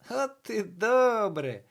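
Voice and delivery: high, loud, drawn-out delight; gleeful. A narrator's reading. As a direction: crow it happily, stretching the words. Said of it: "раділо привидення".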